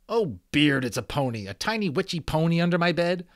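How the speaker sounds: loud, high scoffing singsong; mocking. A narrator's reading. Omri covering up the pony, loud mocking scoff. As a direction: pretend to laugh off the idea. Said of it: "said Omri mockingly".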